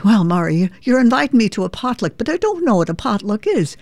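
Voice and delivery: puzzled Irish mum asking about a potluck; Irish mother. Retold or imitated. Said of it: "my mother would say".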